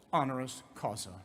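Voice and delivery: ordinary talk, nothing marked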